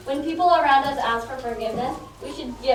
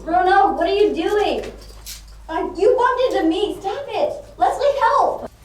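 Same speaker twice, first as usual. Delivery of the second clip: alarmed cry for help; 6 semitones higher, 5 dB louder than usual. Acted. Grabbed by hypnotized friend.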